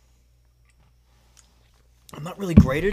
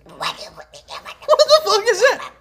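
loud, high, drawn-out disbelief; real startled yell; baffled outburst; 11 dB louder, much slower than usual. Real, not acted. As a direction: shriek it over the noise, utterly baffled. Baffled by partner's bizarre impression.

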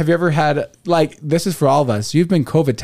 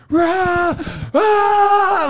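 high hammed-up yells; comic drawn-out scream imitation; mock scream. Imitating the prison scene's overlong comic screaming. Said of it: "comedic effect where they're just".

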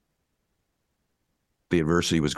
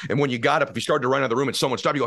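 fired-up motivational preaching; full-force preaching. Acted. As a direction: preach it hard, pitch climbing.

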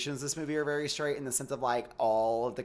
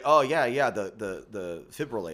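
lower, flat mock straight guy; 4 semitones lower than usual. Shown as a demonstration. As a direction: play it butch and low. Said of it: "And I'm very like".

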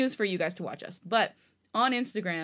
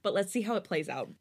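plain reading voice

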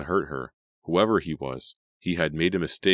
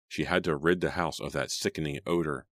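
plain reading voice

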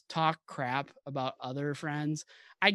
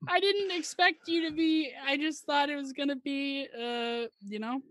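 laughing; 10 semitones higher than usual. Real, not acted. Laughing fit, high voice.

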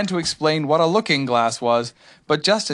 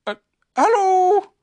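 high, louder calling bear voice; Pooh; 7 dB louder, slower than usual. A narrator's reading. Pooh greets whatever is outside, high call. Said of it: "said Pooh".

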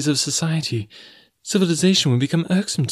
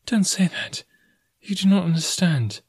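high-pitched, pleading protest; remonstrating; 9 semitones higher than usual. A narrator's reading. Jane begs Tarzan not to think so, remonstrating. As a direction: object in a raised girl's voice. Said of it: "she remonstrated".

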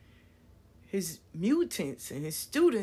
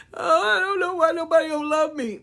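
whiny fake sob, higher and louder; hammed-up crybaby whine; mock crying; 7 semitones higher, 6 dB louder than usual. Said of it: "come to you crying".

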